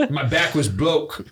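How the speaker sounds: lightly voiced lisping boxer, a bit slow; a hint of a famous boxer's lisp; Mike Tyson impression. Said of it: "look up Mike Tyson spinal".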